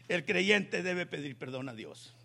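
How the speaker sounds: ordinary talk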